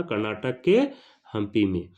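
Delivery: normal talking voice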